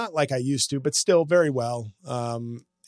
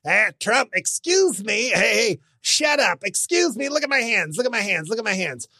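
animated hack impressionist; 7 semitones higher than usual. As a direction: overdo it like a cheap impressionist. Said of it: "animated hacks on the internet going".

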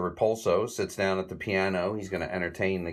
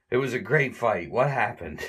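higher, dazed mock stagger; dazed Larry; 4 semitones higher, 4 dB louder than usual. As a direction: sound punch-drunk and lost. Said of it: "And he says".

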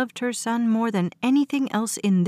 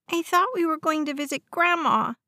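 higher, put-on child's voice; little boy protesting; 7 semitones higher than usual. A narrator's reading. West objects to his mother about Grandma, high childish protest. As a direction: protest as a small boy, pitched up.

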